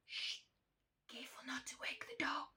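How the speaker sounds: soft, quick whisper; urgent little hush; hushed warning. A narrator's reading. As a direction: shush them and whisper quickly. Warning not to wake dog.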